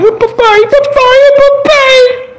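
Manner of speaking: put-on high, drawn-out little kid's voice; child calling dad. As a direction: call out to daddy like a small child. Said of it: "Chamando de pai". (voz de criança chamando o papai)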